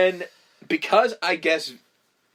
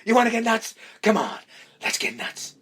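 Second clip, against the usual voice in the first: Keaton yell; 8 semitones higher than usual. Retold or imitated. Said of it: "smacks him and goes".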